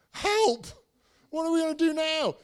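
high, loud panicked cry to God; a frantic prayer for rescue, pitched high; desperate help prayer. Retold or imitated. Husband praying with his wife at the consulate, high panicked cry. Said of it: "those H E L P prayers".

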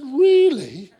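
loud, high laughing disbelief; surprised. Retold or imitated. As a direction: burst out in amazed surprise. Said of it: "She said".

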